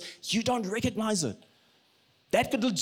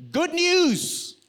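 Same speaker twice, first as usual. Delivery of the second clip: slow, raised proclamation; proclaiming; 9 semitones higher than usual. Real, not acted. Preacher saying he brings news rather than advice, slow proclaiming. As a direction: announce it like a herald.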